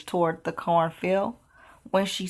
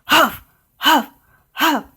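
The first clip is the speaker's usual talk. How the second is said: high, louder panting huffs; dragon huffing; 6 semitones higher, 3 dB louder than usual. Acted. Dragon huffs at Elizabeth, building up fire, high panting huffs. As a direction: puff out sharp dragon breaths.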